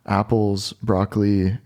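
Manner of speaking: usual speaking voice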